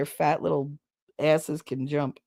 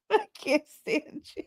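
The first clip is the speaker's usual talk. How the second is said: high, softer laughing words; real laughter; 8 semitones higher, 5 dB quieter than usual. Real, not acted. To the joker who got her, fondly laughing. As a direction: squeeze it out through giggles. Still cracking up at the joke.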